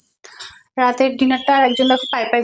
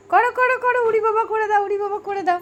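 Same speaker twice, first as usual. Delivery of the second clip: playful urging; 9 semitones higher than usual. Real, not acted. Mother urges baby to do his jump, high playful coaxing.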